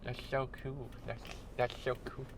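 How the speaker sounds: geek out quietly with slushy s sounds; nerd voice. Pretend. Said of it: "Gotta do the nerd voice".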